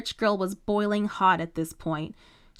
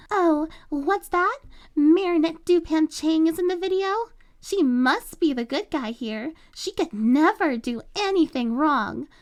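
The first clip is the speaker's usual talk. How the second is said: mock the teacher in a high sing-song; 8 semitones higher, 4 dB louder than usual. A narrator's reading. Chloe mocking everyone's view of Marinette, high and sneering. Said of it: "Putting on a high-pitched mocking voice".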